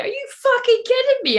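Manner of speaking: very high, loud shriek of disbelief; furious high-pitched outburst; outraged squeal. Retold or imitated. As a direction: screech it like you can't believe the ending. Retold outrage at the film ending.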